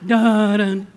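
low, loud "dun-dun" like a movie sting; mock dramatic sting. Preacher mocking doom before the verse, low and loud. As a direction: hit it like an ominous drumbeat.

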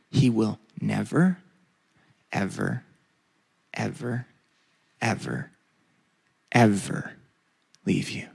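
each ever stretched, fading low. Shown as a demonstration. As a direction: let the evers sink and fade. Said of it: "Do you know what it actually said?".

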